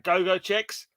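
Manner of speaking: ordinary talk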